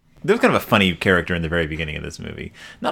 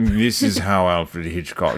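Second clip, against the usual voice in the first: slow, deadpan drawl of a droll host; a self-admittedly terrible impression, stretched out; lightly done lugubrious host voice; Alfred Hitchcock impression; 2 semitones lower, slower than usual. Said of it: "this is a terrible Alfred Hitchcock impression".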